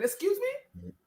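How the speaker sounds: high incredulous squeak; sky-high offended excuse me; outraged excuse me. Real, not acted. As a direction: pitch it way up in disbelief. Outraged fan.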